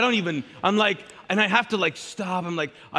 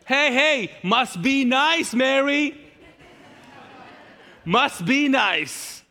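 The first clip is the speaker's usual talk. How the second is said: high, loud, slow sarcastic drawl; Martha's sarcastic must be nice to Mary; passive-aggressive; 8 semitones higher, 5 dB louder, much slower than usual. Retold or imitated. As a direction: snipe at her sister across the room. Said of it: "talk about being passive aggressive".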